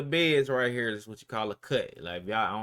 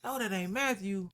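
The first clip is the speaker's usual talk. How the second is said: higher-pitched startled retelling; mock disbelief, pitched up; acted double take; 8 semitones higher, 3 dB quieter than usual. Startled double take on seeing Matthew.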